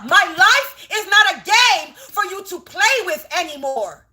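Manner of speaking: loud, high shout, stretching out "game"; angry shout. Real, not acted. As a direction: yell it as a final warning. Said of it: "hear in my voice, the tone".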